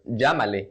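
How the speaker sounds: Llámale with LL as a zh; J sound. Shown as a demonstration. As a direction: say Llámale "zhah-ma-leh". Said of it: "with the J pronunciation".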